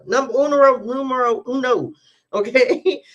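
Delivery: number one, proclaimed with flair; excited announcement. Real, not acted. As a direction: announce it with a grin. Announcing first message.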